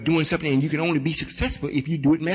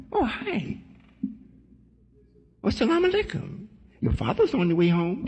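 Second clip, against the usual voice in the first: high, soft imitation of a mother; mother's natural voice; 6 semitones higher, 4 dB quieter than usual. Mother telling child father is coming, natural high soft voice. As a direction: play the mother greeting her child, lightly pitched up. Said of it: "she said it naturally".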